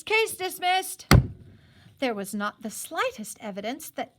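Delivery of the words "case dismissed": loud, slow, formal pronouncement; judge's ruling. Acted. The justice dismissing the case in a loud formal voice. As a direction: declare it from the bench.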